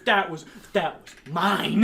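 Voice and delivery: loud, slow, high protest through laughter; mock aggrieved insistence. Friend insists the bitten ham was his, mock aggrieved. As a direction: insist slowly, hammed-up and laughing. Acted quarrel over ham.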